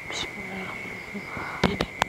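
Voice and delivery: slow, drawn-out whisper; whispered prayer. Real, not acted. Ghost hunter whispering so the spirit stays calm, slow whisper. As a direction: breathe the words out to calm it. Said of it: "Saya bisikin ya".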